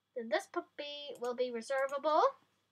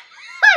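high delighted laugh; 19 dB louder than usual. Real, not acted. Burst of delight over puppies.

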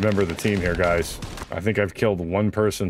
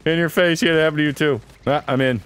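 higher, louder victory gloat; gloating taunt; 7 semitones higher, 3 dB louder than usual. Real, not acted. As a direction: rub the win in their faces. Taunting other players in game.

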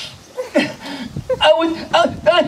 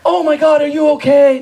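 a hint of a fussing, louder worried voice; worried Judy; 6 semitones higher, 4 dB louder than usual. Judy fusses over the bleeding kid, a touch of worry. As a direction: gush over him a little. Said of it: "If it was Judy, I can imagine she'd be like".

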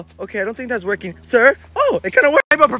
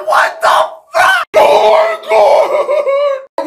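much higher, drawn-out shriek; startled scream; 14 semitones higher, much slower than usual. Acted. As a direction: shriek at a roach. Roach touches him on toilet.

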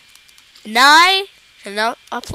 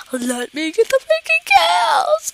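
whiny high-pitched plea, stretched out; high strained whine; 12 semitones higher than usual. Real, not acted. Gamer begging for kills, high whine. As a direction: beg for it in a squeezed high voice.

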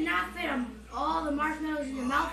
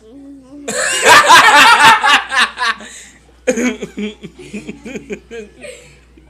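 loud laughing fit; 3 semitones lower, 20 dB louder than usual. Real, not acted.

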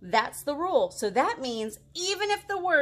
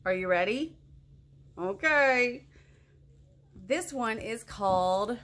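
usual speaking voice

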